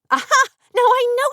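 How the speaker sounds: loud, high burst of triumph; triumphant aha. Acted. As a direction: pounce on it like a detective. Catching her friend out.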